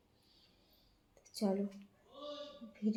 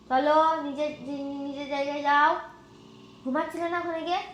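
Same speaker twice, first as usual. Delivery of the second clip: 1 coaxing the cat; 5 semitones higher, 4 dB louder than usual. Real, not acted. Shooing cat to its bed.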